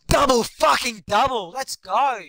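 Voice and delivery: high, loud victory shout; triumphant cheer. Real, not acted. Gamer celebrating his double-double, high loud cheer. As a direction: crow it out in triumph.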